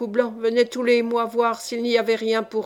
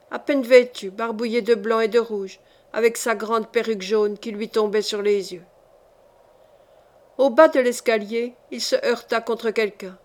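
ordinary delivery

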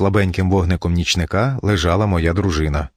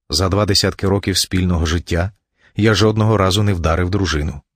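ordinary delivery

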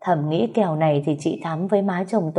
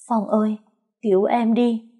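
higher-pitched cry for help; desperate plea; 4 semitones higher than usual. A narrator's reading. Poisoned wife calls her husband to save her, pleading. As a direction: plead in a raised, pained voice.

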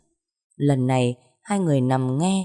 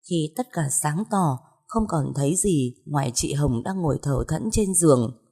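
plain reading voice

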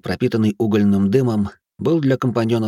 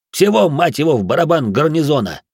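angry shout; 7 semitones higher, 4 dB louder than usual. A narrator's reading. Salih yells in irritation.

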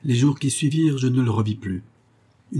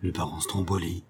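"Stromboli" forced out low and much softer through clenched teeth; through gritted teeth; 4 semitones lower, 8 dB quieter than usual. A narrator's reading. Lieutenant recognising his attacker, through gritted teeth. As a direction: grind out "Le baron Stromboli" under the breath. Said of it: "exclama Stuppendorf en grinçant des dents".

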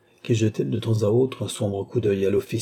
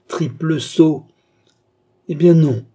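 loud, high burst of contempt; furious scorn; 7 semitones higher, 8 dB louder, slower than usual. A narrator's reading. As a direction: hurl it at the helpless victim. Villain's insult and cruel refusal. (éclat de mépris furieux, voix haute)

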